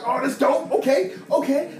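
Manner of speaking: high, excited approval. Retold or imitated. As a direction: get hyped over the game. Playing the kid's paper game.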